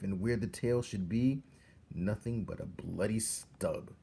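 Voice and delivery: plain reading voice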